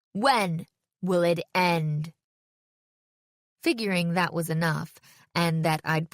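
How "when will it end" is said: very slow, word by word, with pauses; guttural slam poetry. A narrator's reading. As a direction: draw out the last line for a dramatic finish. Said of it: "guttural voice I never would have recognized".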